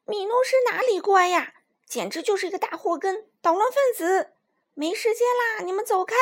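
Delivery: high, indignant and snappy; angry reply. Acted. Acrobats scolding the lion about Minus, high angry voices. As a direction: snap back, fed up and in a hurry. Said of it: "怒气冲冲地回答".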